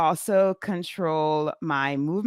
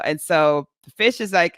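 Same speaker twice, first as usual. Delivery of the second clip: ordinary talk, nothing marked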